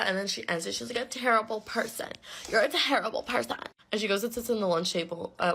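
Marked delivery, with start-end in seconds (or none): 1.0-3.6 higher-pitched imitation of an accuser; mock accusation. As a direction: throw it out like her overblown insult. Acting out ex-friend's accusation.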